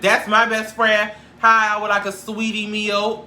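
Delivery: high, loud drive-thru order. Retold or imitated. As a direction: draw out the catchphrase loudly, then order in the same high voice.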